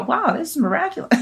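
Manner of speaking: high-pitched wow of mock amazement; amazed doctors. Patient imitating her doctors' reaction to her results, amazed.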